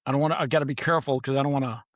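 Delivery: ordinary talk, nothing marked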